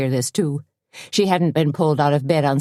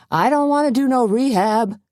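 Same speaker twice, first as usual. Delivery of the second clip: high, whining protest; whimpering; 9 semitones higher than usual. A narrator's reading. Husband refusing rehab, whimpering in a high voice. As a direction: whimper it like a sulky patient. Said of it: "he whimpered".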